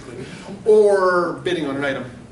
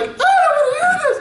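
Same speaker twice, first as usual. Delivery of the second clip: put-on high, loud squeal of a giggling girl; laughing high-pitched little sister; sister's shriek; 6 dB louder than usual. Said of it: "she's like".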